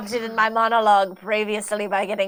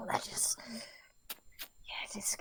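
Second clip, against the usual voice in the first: breathy slow whisper; caught-out whispered aside; 2 semitones lower, 21 dB quieter, slower than usual. Acted. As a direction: whisper it to yourself. Character mutters aside.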